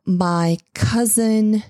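ordinary delivery

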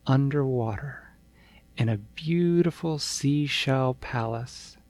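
ordinary delivery, nothing marked

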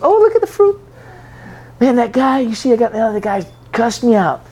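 high, chirpy mock boast of a proud believer. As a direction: crow about your fruit in a high put-on voice.

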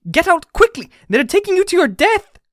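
very high, loud frantic warning; panicked, desperate shrieking; terrible cry. A narrator's reading. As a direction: scream at a friend to escape. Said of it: "cried Clover in a terrible voice".

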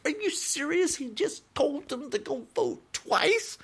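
are you kidding me, high and stunned; incredulous disbelief. Retold or imitated. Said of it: "I was like".

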